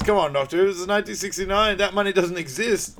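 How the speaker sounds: high, loud exasperated objection; mock incredulous protest. Imitating the president answering the ransom demand, mock incredulous protest. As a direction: raise your voice in acted disbelief.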